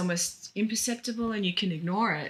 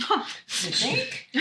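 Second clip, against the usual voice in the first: sarcastic laugh; 6 semitones higher than usual. Real, not acted.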